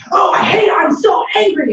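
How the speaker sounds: high mock shout of anger; angry outburst. Shown as a demonstration. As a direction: shout out how angry you feel. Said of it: "saying things like".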